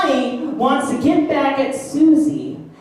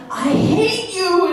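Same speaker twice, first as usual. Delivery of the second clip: put-on childish spite; 7 semitones higher, slower than usual. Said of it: "might just say".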